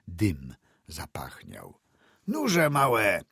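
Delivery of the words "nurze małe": high, loud cheerful shout; cheerful call to horses. A narrator's reading. Driver cheerfully calls to his horses near home, loud. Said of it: "zawołał wesoło na konie".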